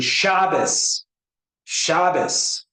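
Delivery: "Shabbos" with the first syllable punched high; stress on SHA. Shown as a demonstration. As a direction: hit SHA hard in "Shabbos", ending in s.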